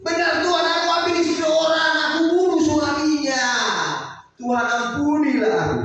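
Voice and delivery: high, loud anguished plea; crying confession. Acted. David kneeling before God, crying out loud and high. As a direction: cry out for mercy. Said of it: "berlutut menangis-nangis".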